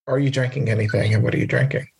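ordinary talk, nothing marked

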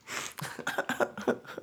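wheezy, voiceless laugh; quiet fit of laughter; private laughter. Real, not acted. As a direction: laugh helplessly at your own bad joke. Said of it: "I'm laughing at bad jokes".

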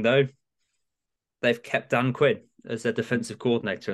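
ordinary talk, nothing marked

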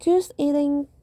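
ordinary talk

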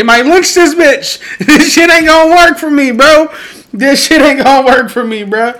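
high-pitched, loud, laughing protest; comic alarm. Real, not acted. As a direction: squeal it out in amused panic.

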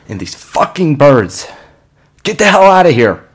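high, loud furious shout; angry yell. Acted. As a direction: drive the birds off in rage. Yelling at birds.

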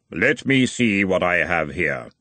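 slow, unearthly Death; grim reaper voice. Acted. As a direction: speak as Death, unhurried. Death reaches into robe.

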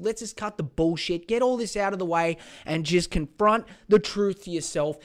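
ordinary talk

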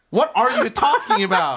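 high, loud incredulous cry through laughter; laughing, exasperated shout at a friend; mock outrage. Real, not acted. Co-host argues over whether Remy talks, mock outrage.

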